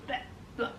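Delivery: whispered retching noise; imitating a retch at the fridge; mock gagging. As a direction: fake a quick gag of disgust. Mimicking nausea at the fridge.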